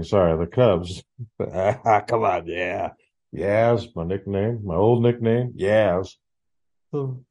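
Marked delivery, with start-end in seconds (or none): none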